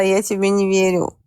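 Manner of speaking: usual speaking voice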